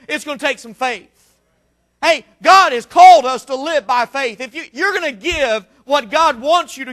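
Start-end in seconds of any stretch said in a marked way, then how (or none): none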